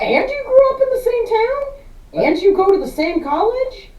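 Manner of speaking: very high-pitched disbelieving questions; mock incredulous rant. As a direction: call out the character in squeaky disbelief. Mock challenge to the movie's jock.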